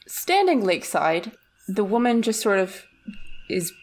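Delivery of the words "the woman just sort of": ordinary talk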